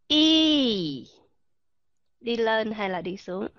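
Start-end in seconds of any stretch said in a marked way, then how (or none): 0.1-1.1 long English "y" held out clearly; teacher demonstrating the long ee vowel; long ee demo. Shown as a demonstration. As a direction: model a clean, drawn-out "y" sound.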